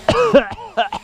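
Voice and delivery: sharp cough, bug in throat; high choking cough. Real, not acted. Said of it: "You have to excuse me".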